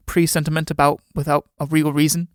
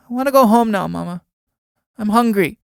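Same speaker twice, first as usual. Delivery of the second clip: put-on high, whiny little girl voice; tired child; 6 semitones higher than usual. Little girl begging her mama to take her home, whining.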